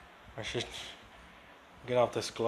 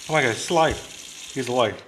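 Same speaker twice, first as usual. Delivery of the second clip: louder burst of relieved surprise; real surprised relief; 7 dB louder than usual. Real, not acted. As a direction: exclaim it, amazed and relieved. Finds the frog is alive.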